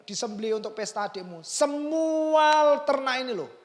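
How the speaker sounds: high, loud emphatic reassurance; father loudly insisting to his elder son; insistent father. Retold or imitated. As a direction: press it home like a father convincing his son. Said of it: "sampai harus menegaskan lagi".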